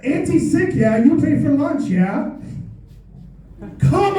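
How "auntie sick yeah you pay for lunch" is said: normal talking voice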